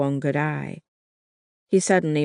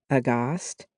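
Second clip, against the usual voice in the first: plain reading voice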